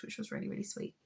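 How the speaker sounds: whispered aside; tender whispered aside; soft aside. Real, not acted. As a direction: drop to a hushed whisper. Soft aside about baby.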